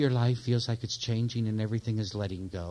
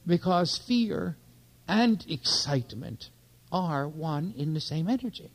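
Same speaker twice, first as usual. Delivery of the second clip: slow emphasis; 4 semitones higher, slower than usual. Shown as a demonstration. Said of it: "teaching point drawn out".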